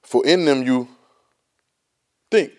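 long pause, then "think" set apart; slow lead-in, pause before "think"; stress on think. Shown as a demonstration. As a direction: hold off, then land "think" on its own. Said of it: "underline the word think".

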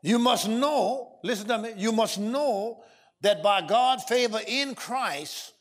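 drawn-out words, each one weighed; slow weighty proclamation. Real, not acted. Preacher slowly stressing each word for notes. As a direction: make them write it down.

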